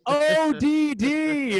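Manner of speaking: spelling it out. Retold or imitated. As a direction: spell it out painfully slowly. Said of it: "he's like".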